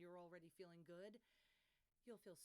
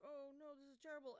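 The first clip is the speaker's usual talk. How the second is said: mock dismay; 5 semitones higher, 5 dB louder than usual. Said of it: "she was like".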